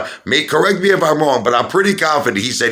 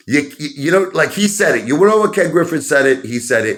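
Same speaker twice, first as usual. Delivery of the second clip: normal talking voice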